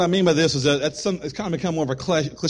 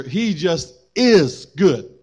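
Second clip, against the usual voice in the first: "is" set apart and punched, slowly; slow down and land hard on "is"; stress on IS; much slower than usual. Real, not acted. Preacher contrasting nature and being, heavy stress on "is".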